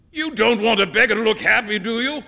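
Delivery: high, loud, quick retort; painter's emphatic comeback; brisk painter. A narrator's reading. Painter answering his friend about the beggar, brisk.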